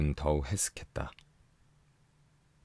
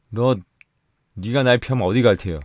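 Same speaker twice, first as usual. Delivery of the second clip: loud, high command; husband shouts after his running wife; 6 semitones higher, 9 dB louder than usual. A narrator's reading. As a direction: stop her with a bellow. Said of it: "의미 있는 호령에".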